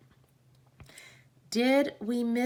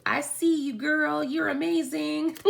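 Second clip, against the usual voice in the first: high, excited shout-out; cheering a friend; 8 semitones higher than usual. Real, not acted. Greeting viewer Jennifer in chat, high excited cheer. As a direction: gush with delight at her.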